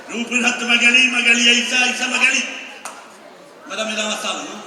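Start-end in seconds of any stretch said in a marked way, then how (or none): 0.1-2.4 showman announcer. Acted. Magician introducing volunteers.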